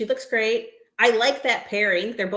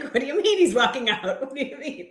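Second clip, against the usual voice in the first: mock disbelief; 4 semitones higher than usual. Recalling her reaction to him walking out, laughing disbelief. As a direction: replay your bafflement through laughter.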